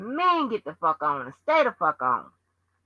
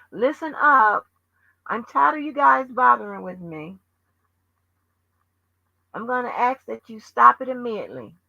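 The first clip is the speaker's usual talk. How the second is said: mock formal announcement; 5 semitones higher than usual. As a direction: play the polite official asking them to stop.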